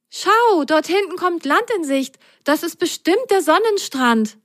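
high, delighted call spotting land; high happy child call; joyful. Acted. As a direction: point out the beach with joy. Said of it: "rief Sine freudig".

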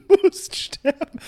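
laughing. Real, not acted.

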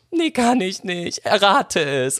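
slow, very high squeaky sobbing imitation; hammed-up weepy refusal; mock tearful whine. Crying child unable to tell mother, exaggerated high whine. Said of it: "hab dann geheult".

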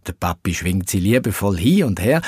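usual speaking voice

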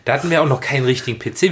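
normal talking voice

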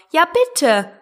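high-pitched chiming plea; chorus echo. A narrator's reading. Animals begging the mouse to return, in chorus. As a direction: chime in like the other animals. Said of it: "wiederholten im Chor".

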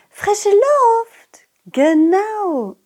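high, slow, drowsy teacher voice; sleepy old teacher. Acted. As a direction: yawn it out like a tired old teacher.

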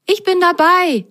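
high, loud and keen; eager to travel. Acted. Rosenrot agrees to the trip, high and keen. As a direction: jump at the chance. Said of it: "sagte Rosenrot reiselustig".

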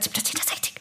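"Siebter zehnter, sechzig" whispered close to his ear. Retold or imitated. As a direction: secretly whisper the true age. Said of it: "ganz nah zu ihm gesagt". (geflüstert, dicht am Ohr des Polizisten)

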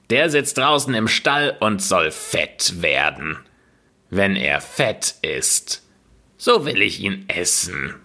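put-on a wicked witch barking orders at a lazy girl; witch calling. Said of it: "rüttelte sie wach und rief".

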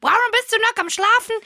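mock mother. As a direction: put on a high nagging mum.

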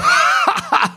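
high laugh bursting out; high burst of laughter. Real, not acted. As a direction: laugh out loud at the punchline. Laugh after punchline. (hohes, herausplatzendes Lachen)

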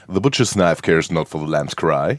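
low, slow deep voice. Shown as a demonstration. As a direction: drop the voice deep and draw it out. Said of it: "kann man sie mit tiefer Stimme sehr, sehr gut aussprechen".

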